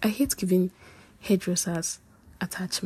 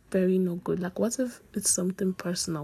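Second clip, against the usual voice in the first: usual speaking voice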